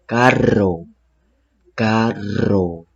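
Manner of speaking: "carro" with a strong rolled rr; trilled rr. Shown as a demonstration. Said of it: "suena remarcada y continua".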